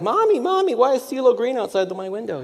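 put-on high, excited little kid calling mommy; child's voice. Child eating oatmeal calling to mommy, high kid voice. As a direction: squeak it out like a startled kid.

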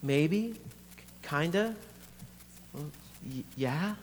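hesitant, with long pauses; reluctant admission. Acted. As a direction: admit it haltingly, word by word. Lucy reluctantly admits her worry.

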